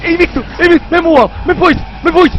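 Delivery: high, loud frantic urging to flee; panicked yelling. Real, not acted. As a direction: yell to get away in terror. Fleeing monster panicking.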